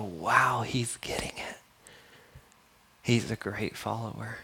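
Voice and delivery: soft, breathy-quiet amazement, almost whispered; hushed awe. Acted. Preacher voicing a reader struck by the story, hushed awe. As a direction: drop to a near-whisper in wonder. Voices the reader's awed reaction.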